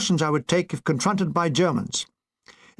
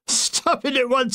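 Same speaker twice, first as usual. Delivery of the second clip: stifling laughter; 8 semitones higher, slower than usual. Acted. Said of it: "through his own laughter".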